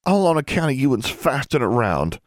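accusing. A narrator's reading. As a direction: put the blame on them in a high voice. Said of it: "Forbearance accused".

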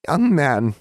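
high, loud hag's rebuke; prim reprimand. A narrator's reading. Hag to a joking boy, prim reprimand. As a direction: pull the boy up short.

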